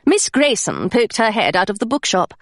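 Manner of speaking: ordinary delivery, nothing marked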